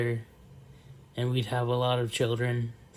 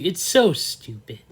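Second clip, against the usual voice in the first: exasperated; 5 semitones higher, 8 dB louder than usual. Real, not acted. Outrage at firing.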